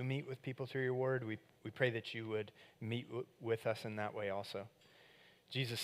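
soft, hushed prayer voice; a pastor leading a softly spoken prayer. Acted. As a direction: pray gently and quietly to Jesus. Pastor prays aloud for the congregation.

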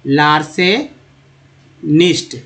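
लार्सेनिस्ट stretched very slowly, every sound held; extra slow model; very slow demo. Shown as a demonstration. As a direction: drag out लार्सेनिस्ट for the class. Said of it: "इसको याद रखिए".

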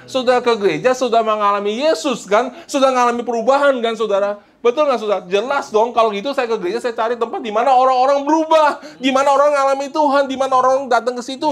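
high, insistent sermon voice rising to a climax; rousing, high-pitched exhortation; impassioned preaching, building. Real, not acted. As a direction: build urgency phrase by phrase.

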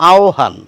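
আহ্বান said as "aohban", with an o for the b; আহ্বান with o sound then h, the older form; old pronunciation. Shown as a demonstration. Teacher demonstrating the old standard আহ্বান. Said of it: "এই উচ্চারণটি শুনতে পারেন".

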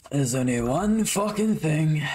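slow, gruff Brummie gangster growl; Thomas Shelby impression. Gamer trying out his Peaky Blinders voice, a light Brummie growl. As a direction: lean into a lightly done Birmingham gangster drawl. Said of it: "I'm trying to do Thomas Shelby impression".

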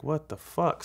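high-pitched, baffled exclamation; retold disbelief. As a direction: react as if you can't believe it. Retold shock at arm grab.

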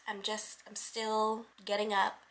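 soft, hoarse early-morning voice. Real, not acted. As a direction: speak with almost no voice left. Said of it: "I have no voice".

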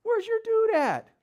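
high, loud mock taunt. Elijah taunts the prophets about their missing gods, mocking. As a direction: jeer at rivals in a put-on high voice. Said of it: "He starts mocking them".

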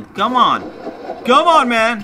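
loud, high exasperated plea; rising real frustration; getting pissed. Real, not acted. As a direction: shout at the stubborn game. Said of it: "I'm getting pissed here".

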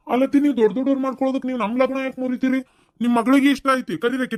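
high, fast, pleading protest; agitated protest. Acted. Young man pleading with his aunt over the broken marriage, agitated. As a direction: plead hotly against the elders.

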